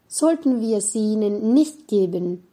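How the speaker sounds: ordinary talk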